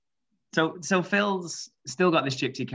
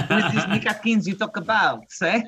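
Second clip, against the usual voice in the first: put-on laughing, raised French Canadian accent; mock French Canadian, louder and higher; 6 semitones higher, 5 dB louder than usual. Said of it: "he does his French Canadian accent".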